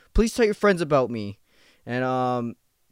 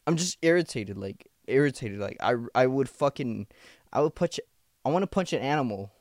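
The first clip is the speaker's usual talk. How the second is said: ordinary talk, nothing marked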